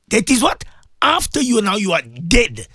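usual speaking voice

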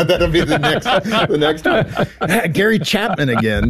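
laughing through the words, pitch up; real giggling. Real, not acted. Host laughing at the joke about her spouse, giggling. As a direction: giggle it out, half amused at yourself. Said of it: "I'm giggling".

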